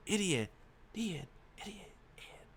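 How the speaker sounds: word repeats, fading away softly; dying-away echo of a shout; mimicked echo. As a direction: echo it across the room, ever fainter. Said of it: "I shout across through the room".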